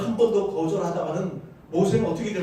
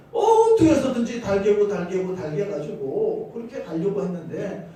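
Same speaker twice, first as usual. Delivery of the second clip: ordinary delivery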